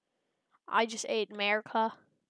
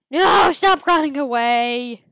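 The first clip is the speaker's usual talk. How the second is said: very loud, slow, stretched-out yell; exasperated, drawn-out shout; frustrated shout; 3 semitones higher, 14 dB louder than usual. Real, not acted. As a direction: holler after someone escaping, dragging the words. Prey keeps escaping in game.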